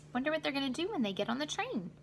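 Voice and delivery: quick musing aside. Real, not acted. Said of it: "aside question to listeners".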